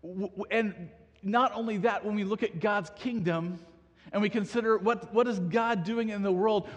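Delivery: ordinary delivery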